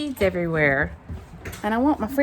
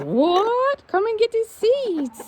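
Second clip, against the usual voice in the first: high, loud rooster voice; Roscoe; 14 semitones higher, 6 dB louder, slower than usual. Acted. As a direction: call the hens excitedly to the food. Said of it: "Roscoe's telling the girls, he says".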